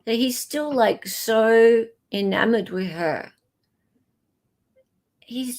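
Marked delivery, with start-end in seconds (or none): 5.2-5.6 whispered sadness. Real, not acted. Sad reaction to Nick.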